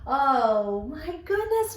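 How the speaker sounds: usual speaking voice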